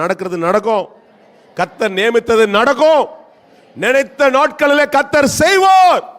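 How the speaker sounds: rising call for the congregation to repeat; preached declaration. Acted. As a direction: build to a triumphant proclamation.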